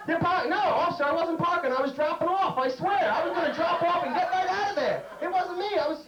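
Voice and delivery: squeaky panicked plea to the officer; panicked pleading. Retold or imitated. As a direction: beg the cop not to ticket you.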